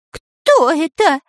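high-pitched, stretched scared question; frightened. A narrator's reading. Dyrka hears a voice from the sky, frightened. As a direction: squeak it out in fright. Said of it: "перепугался Дырка".

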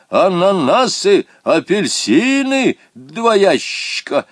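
high, loud, drawn-out call; stretched-out eager yell; excited shout. A narrator's reading. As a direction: holler it like cargo being loaded. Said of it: "кричал Помидоров".

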